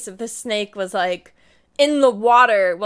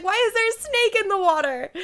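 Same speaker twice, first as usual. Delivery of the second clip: alarmed whine; 11 semitones higher than usual. Retold or imitated. Retold alarmed question.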